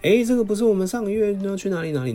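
little son. Retold or imitated. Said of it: "就说".

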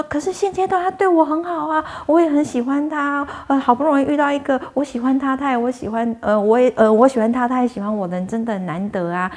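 high, anxious pleading to the bodhisattva; a worried woman clinging to her new love; nervous pleading. Retold or imitated. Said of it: "那个女的就很紧张".

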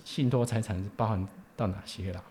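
ordinary delivery

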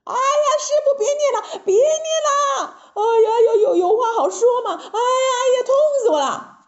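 very high, acted wailing pleas; agonized high-pitched cries; writhing in pain. A narrator's reading. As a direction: yelp and beg for it to stop. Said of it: "疼在， 在地上直打滚".

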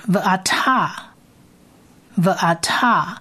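Va'ata said slowly, va-a-ta; slow model pronunciation. Shown as a demonstration. Teacher models Va'ata for listeners, slow pronunciation. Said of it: "第二个字呢是".

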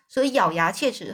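ordinary delivery

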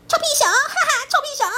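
high, loud laughing taunt; loud teasing. Acted. Pipi loudly taunts the bear again with laughter. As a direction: yell it out with a cackle. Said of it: "一看到熊豆豆就大声地说".

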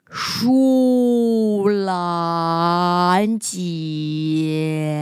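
stretched out, very slow call; super slow sleepy sloth. Acted. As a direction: draw the name out endlessly slowly. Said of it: "懒懒懒说话好慢好慢".

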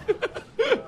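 high, breathy giggle; high squealing laugh. Real, not acted. Storyteller recalls the reveal, squealing laugh.

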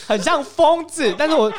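high, loud laugh-cry, slowed; half crying, half laughing at yourself; loud teary giggle; laughing through tears. Real, not acted. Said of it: "你这个表情是要哭还是在笑".

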